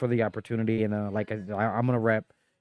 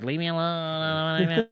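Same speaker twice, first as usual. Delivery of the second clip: put-on sulky teenager; 4 semitones higher, much slower than usual. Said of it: "she's, you know, a teenager".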